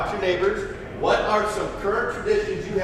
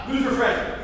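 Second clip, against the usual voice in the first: mocking; 8 semitones higher than usual. Mocking freshmen during hazing.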